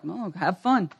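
hushed sly indulgence; mock indulgent enemy. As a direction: play the tempter letting him off easy. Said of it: "he's like".

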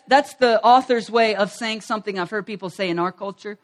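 ordinary talk

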